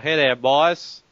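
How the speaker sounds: hammed-up Southern drawl, dragging each word; Southern cowboy accent done for laughs. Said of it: "southern drawl".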